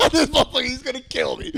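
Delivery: high, loud, through laughter; laughing. Real, not acted. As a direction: retell your dread while cracking up. Laughing as he retells his dread.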